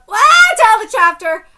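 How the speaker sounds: loud, high burst of excitement; thrilled high yell; excited outburst. Real, not acted. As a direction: shout it out as the title appears. Excited reaction to chapter title.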